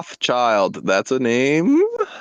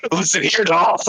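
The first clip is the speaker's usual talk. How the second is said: manic out of breath; 7 semitones higher than usual. Retold or imitated. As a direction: imitate "doll" gasped out manic. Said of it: "just the fucking manic out of breath".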